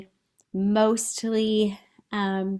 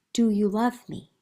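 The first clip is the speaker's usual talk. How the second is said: voicing Miranda. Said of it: "Miranda says".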